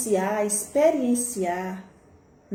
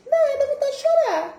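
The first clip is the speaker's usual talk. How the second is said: exaggerated exclamation; 5 dB louder than usual. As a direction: burst out as if obvious. Exaggerated answer to own question. (exclamação exagerada, bem aguda e alta)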